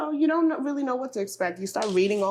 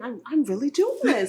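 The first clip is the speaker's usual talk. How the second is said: higher-pitched, half-laughing disbelief; retold wonder, lightly amused; amazed realization; 5 semitones higher than usual. New mom telling herself she is parenting, amazed realization. Disbelief in first years of motherhood.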